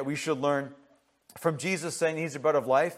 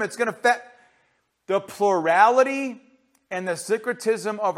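higher-pitched, driving pulpit delivery; forceful preaching; 8 semitones higher, 8 dB louder than usual. Real, not acted. Preacher warns congregation against heavy false thinking, forceful preaching. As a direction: preach it hard, punching key phrases.

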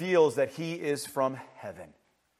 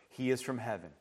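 ordinary talk

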